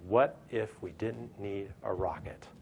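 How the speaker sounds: slow, spelling out every word; slow emphatic question. Retold or imitated. Speaker to audience, founders' question slowed for weight. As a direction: lay the question down slowly.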